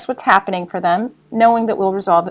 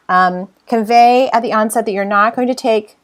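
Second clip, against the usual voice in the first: ordinary talk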